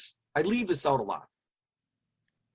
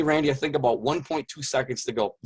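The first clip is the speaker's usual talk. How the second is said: usual speaking voice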